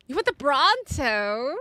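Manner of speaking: ordinary talk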